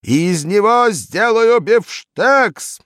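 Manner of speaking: high, loud, slow raging bellow; slow loud furious yell; thundering roar. A narrator's reading. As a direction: thunder it through the whole building. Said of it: "гремело школьное здание".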